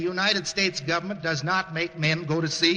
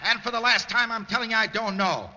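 defiant raised voice; shouting back; 4 semitones higher than usual. Acted. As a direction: shout back at him over the bombs. Sailor shouts back over bombing.

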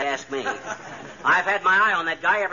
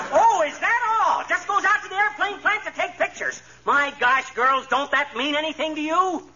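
high, slow, mocking disbelief; sarcastic disbelief; 6 semitones higher than usual. Husband scoffs at Alice's excuse, sarcastic.

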